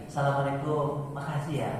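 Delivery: soft, humble thanks; lightly voiced father, soft thanks; gentle thanks. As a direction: thank him quietly and kindly. Father thanks keeper while leaving.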